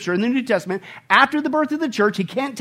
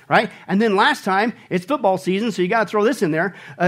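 ordinary talk

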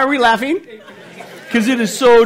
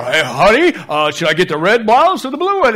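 imitating wife; 5 dB louder than usual. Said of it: "you sit there".

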